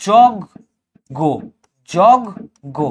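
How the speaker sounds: "যজ্ঞ" as jawg, pause, go; broken at the cluster. Shown as a demonstration. Teacher showing a stop in the cluster, "যজ্ঞ" split jawg - go. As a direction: say "যজ্ঞ" slowly, stopping between syllables. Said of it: "তুমি থেমে আবার উচ্চারণ করছো".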